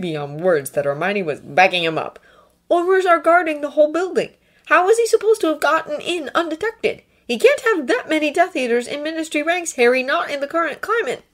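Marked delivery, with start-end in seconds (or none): 2.7-11.2 higher, earnest reasoning; Draco reasoning. A narrator's reading. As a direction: lay out the logic earnestly.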